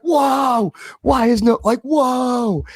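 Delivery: high excited wow; amazed. Retold or imitated. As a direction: gasp it out in awe. Amazed reaction to Crosby goal.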